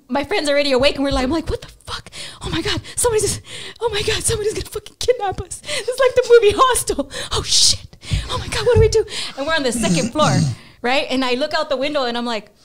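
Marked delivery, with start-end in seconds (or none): 1.5-9.0 high, fast, frantic panic; panicked. Retold or imitated. To his friend as strangers try the door, high panicked babble. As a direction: shriek it out in mock terror.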